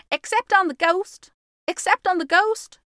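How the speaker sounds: high, loud excited shriek; high-pitched shouted chant; shrieking twins. A narrator's reading. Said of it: "shrieked the twins".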